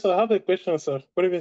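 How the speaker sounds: ordinary delivery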